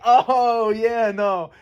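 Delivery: high, drawn knowing "Oh, yeah, no"; knowing mock. Canadians react knowingly, voiced high and mocking. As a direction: play the knowing sigh, pitched high. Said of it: "They're like".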